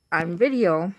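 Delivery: ordinary delivery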